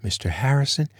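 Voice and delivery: Mister Harrison pitched high in shock; shocked indignation. Acted. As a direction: gasp out Mister Harrison, scandalized. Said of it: "Charlotte gasped".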